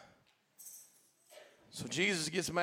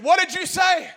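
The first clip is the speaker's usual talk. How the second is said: dad scolding; 12 semitones higher, 12 dB louder than usual. Retold or imitated. Dad scolding his boy.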